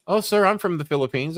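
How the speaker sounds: a young operator gushing to a caller, voiced high and sweet; imitating sweet Filipino operator. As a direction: play the chirpy call-center girl. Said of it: "[name] answers the phone".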